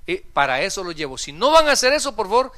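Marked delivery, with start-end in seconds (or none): 1.2-2.5 loud ultimatum, starting high and coming down; stern warning. Retold or imitated. Pastor warns helpers not to come along, loud stern warning. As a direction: warn them forcefully, as an ultimatum.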